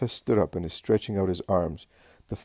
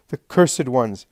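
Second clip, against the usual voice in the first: high, loud curse; swearing; 5 semitones higher, 6 dB louder, slower than usual. A narrator's reading. Man cursing those who misled him, swearing. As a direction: curse them bitterly. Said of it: "And he swears".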